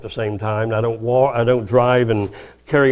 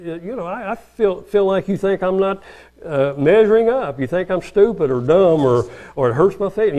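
high, gentle sincere explaining; calm sincere telling; 7 semitones higher than usual. Shown as a demonstration. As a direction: share the hurt without blaming. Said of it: "I think".

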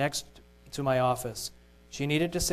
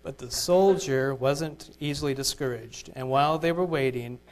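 ordinary talk

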